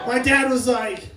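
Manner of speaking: usual speaking voice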